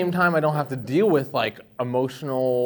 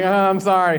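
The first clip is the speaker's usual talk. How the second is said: mock apology; 8 semitones higher, 11 dB louder, slower than usual.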